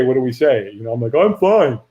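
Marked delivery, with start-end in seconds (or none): 1.1-1.8 higher, overly quick reassurance; pretending to be fine. Man insisting he's okay before the firewalk, falsely fine. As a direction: put on a mild "all good" front. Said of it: "the moment we're not okay".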